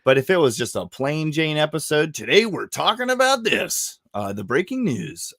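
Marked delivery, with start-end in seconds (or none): 2.1-5.3 high, hyped-up announcer parody; exaggerated radio intro; mock show host. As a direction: announce it like a big show topic, pitched way up. Imitates host announcing a plain episode.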